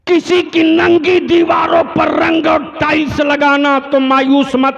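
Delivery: high, loud rousing sermon; fiery preaching. Acted. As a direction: rouse the workers with full force. Preaching at full force.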